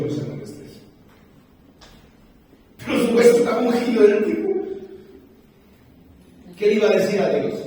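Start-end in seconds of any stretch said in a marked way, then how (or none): 2.8-7.6 higher, louder and fast, hammering it home; fiery preaching. Acted. Preacher to congregation about Eliseo, fast loud fiery preaching.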